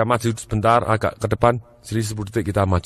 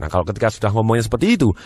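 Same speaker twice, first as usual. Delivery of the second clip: ordinary delivery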